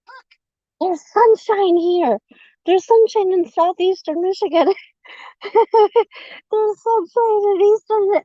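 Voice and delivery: high, slow, delighted sing-song; excited. Real, not acted. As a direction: gush over the sunshine. Excited about sunshine.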